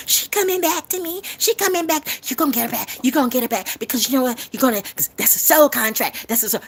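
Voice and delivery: high-pitched mimicking voice; mock love adviser. Mimicking someone promising a lover will come back, high mock voice.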